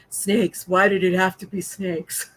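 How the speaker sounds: lightly voiced weary hero line; a hint of a movie hero dreading snakes; Indiana Jones. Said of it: "like an Indiana Jones moment".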